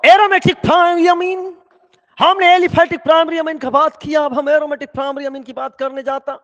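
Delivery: high, booming topic call; loud announcing. Real, not acted. Teacher calls the class back to aromatic primary amines, loud announcing. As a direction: belt it out high and sustained, like a proclamation.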